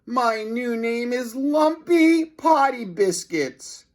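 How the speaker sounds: high, slow wail; a hint of a wailing principal; despairing principal. A narrator's reading. Principal despairs over name.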